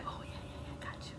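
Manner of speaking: whispered eager agreement; whispering. Retold or imitated. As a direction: breathe it out, no voice at all. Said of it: "The other guy was like".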